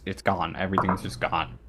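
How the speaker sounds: ordinary delivery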